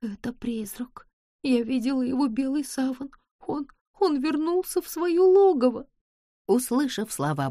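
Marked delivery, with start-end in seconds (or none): 0.0-1.1 slow, scared whisper; trembling whisper. Acted. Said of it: "дрожащим голосом произнесла". 1.4-5.9 put-on higher, frightened girl's voice; rising panic, voice climbing up; trembling voice. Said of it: "дрожащим голосом произнесла".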